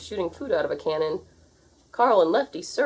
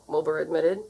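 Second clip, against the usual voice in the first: ordinary delivery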